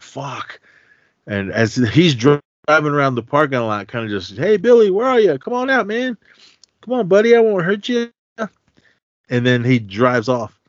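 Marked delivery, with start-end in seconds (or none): 0.0-0.6 hushed, very soft curse; whispered curse. Retold or imitated. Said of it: "Ralphie's like". 4.4-8.1 high singsong luring voice; creepy coaxing call. Retold or imitated. Killer calling from car.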